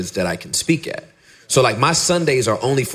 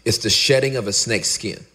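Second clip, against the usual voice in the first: usual speaking voice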